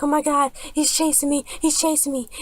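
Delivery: high-pitched imitation of a panicked girl; mock shrieking. Amy on camera being chased, high mock shriek. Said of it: "some really screaming, shrieking noise".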